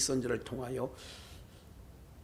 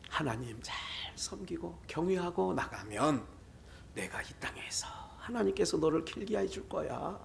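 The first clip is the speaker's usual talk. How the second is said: hushed, whispered blessing; soft dying wish; 8 dB quieter than usual. Retold or imitated. A dying elder blessing his descendants in a whisper. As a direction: breathe it like last words. Elders' last words, softly given.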